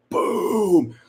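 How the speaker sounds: high, drawn-out "boom" sound effect; mind-blown boom. Retold or imitated. Sister mimics brother learning about implants, mind-blown boom. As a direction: imitate an explosion, pitched well up.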